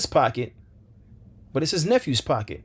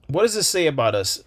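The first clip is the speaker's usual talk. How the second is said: usual speaking voice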